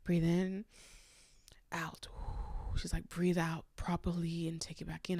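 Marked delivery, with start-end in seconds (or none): 0.0-2.1 soft slow breathing cue, fading out; calming breathing cue. Retold or imitated. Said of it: "she's just like". 3.1-5.2 soft whispered breathing guidance; calming whisper. Retold or imitated. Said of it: "Her voice is so calming".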